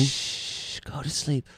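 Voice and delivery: soft hushing whisper; whispering over a crib. Pretend. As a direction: shush him gently to sleep. Hushing friend to sleep.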